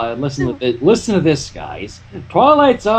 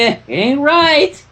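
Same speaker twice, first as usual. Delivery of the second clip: slow drawn-out warning; 8 semitones higher, much slower than usual. A narrator's reading.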